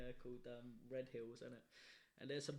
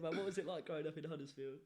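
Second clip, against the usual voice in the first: ordinary talk, nothing marked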